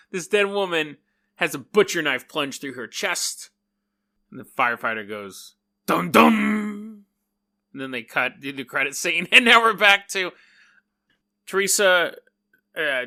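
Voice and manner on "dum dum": dramatic sting. Acted. Dramatic reveal of dead body.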